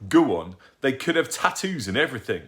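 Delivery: teasing. Acted. Lancelot egging Mum on.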